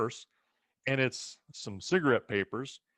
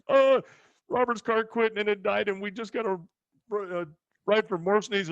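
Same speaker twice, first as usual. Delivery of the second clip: much higher, louder, rushed explanation; nervous fast excuse; 10 semitones higher, 5 dB louder than usual. Retold or imitated. A teen rushing his excuse to an angry grandpa. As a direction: blurt it out fast to dodge trouble. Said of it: "talk as fast as I can".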